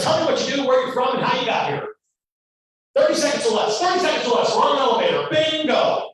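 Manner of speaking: brusque producer. Retold or imitated. Producer demanding an elevator pitch, a mock brusque boss. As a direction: snap out the orders like a fast-talking mogul. Producer grilling young actor.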